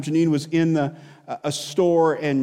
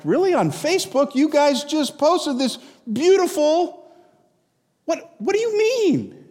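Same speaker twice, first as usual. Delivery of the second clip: higher, incredulous; mock disbelief; 8 semitones higher than usual. Pastor reacts to couple's divorce news, disbelieving. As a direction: act floored by the news.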